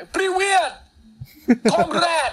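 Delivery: high-pitched hammed-up Russian-accented call; mock Russian accent shout. Podcaster mimicking a wrestler calling from the stage, high mock Russian accent. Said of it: "ตะโกนจากเวที".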